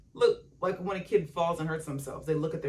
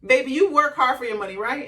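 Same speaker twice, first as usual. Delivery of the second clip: higher, louder, fast grandma voice; grandma urging granddaughter to spend, higher and louder; imitating grandma; 7 semitones higher, 9 dB louder than usual. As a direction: sweetly scold like a grandma. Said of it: "call my grandma".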